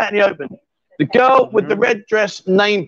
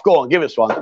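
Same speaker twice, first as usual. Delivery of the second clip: normal talking voice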